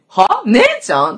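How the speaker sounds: sharp high "huh? sister?"; baffled retort. Retold or imitated. Drunk friend shoots back in high, loud disbelief.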